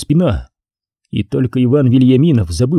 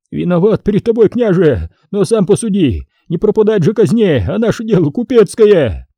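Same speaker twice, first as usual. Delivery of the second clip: rapid patter; 7 semitones higher than usual. A narrator's reading. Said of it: "сыпал и сыпал скороговоркой".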